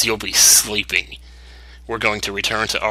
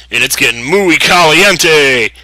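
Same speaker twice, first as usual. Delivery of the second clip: hammed-up hot boast; 5 semitones higher, 8 dB louder, slower than usual. Reading a hero's battle quip.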